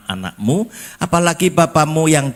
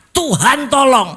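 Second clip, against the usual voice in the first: acted cry for help; 13 semitones higher, 5 dB louder than usual. Believer crying to God for help, high pleading shout. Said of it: "Anda itu baru teriak".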